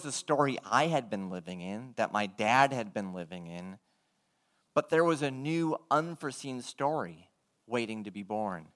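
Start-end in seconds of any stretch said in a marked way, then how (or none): none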